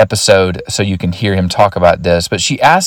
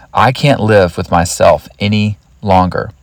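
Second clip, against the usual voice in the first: deliberate repeat. Retold or imitated. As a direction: let each part of the phrase land separately. Quoted thought repeated slowly for emphasis.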